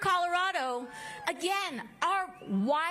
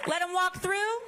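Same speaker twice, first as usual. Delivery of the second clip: man down shout; 6 semitones higher than usual. Real, not acted. Clearing path for medics.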